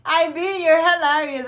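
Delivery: loud, laughing through words. Real, not acted. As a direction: still cracking up. Still laughing after joke.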